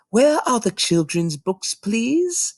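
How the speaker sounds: put-on high, polite child's voice; little girl voice. Small girl asking the librarian for children's books, high and polite. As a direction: ask sweetly like a tiny girl.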